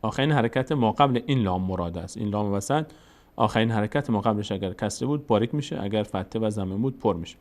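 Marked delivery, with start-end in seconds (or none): none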